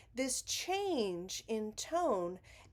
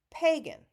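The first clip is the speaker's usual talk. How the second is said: Pagan with PAY hit high and loud; stress on PAY; 7 semitones higher, 8 dB louder than usual. Shown as a demonstration. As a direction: punch the first syllable of Pagan, PAY-gan. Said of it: "Pay is the stressed syllable".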